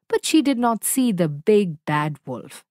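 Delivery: ordinary delivery, nothing marked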